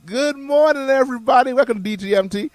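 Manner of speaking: high, loud radio hello; excited greeting. Acted. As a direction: boom it out to the listeners. Radio host greets listeners.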